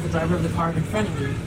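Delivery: ordinary delivery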